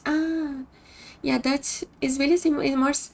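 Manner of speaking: usual speaking voice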